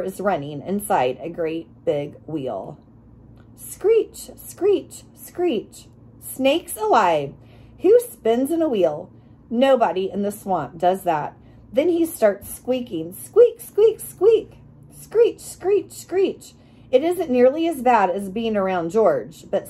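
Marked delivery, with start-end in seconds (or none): 3.6-5.9 high squealing screech, dropping each time; screeching wheel. Retold or imitated. Narrator mimics the neighbor's wheel, high screech. 13.1-14.5 high, loud, tiny squeaking voice; hamster squeaks. Retold or imitated. Narrator imitates the hamster next door, loud squeaks. 14.9-16.5 high squealing screech, dropping each time; screeching wheel. Retold or imitated. Narrator mimics the neighbor's wheel, high screech.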